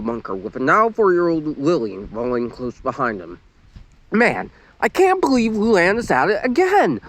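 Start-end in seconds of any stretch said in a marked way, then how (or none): 4.1-7.0 pouting. A narrator's reading. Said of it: "Lori pouted".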